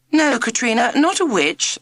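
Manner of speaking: sharp. A narrator's reading. The teacher sharply correcting Katrina in front of the class. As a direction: stop her firmly, no nonsense. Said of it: "said Miss Wiz sharply".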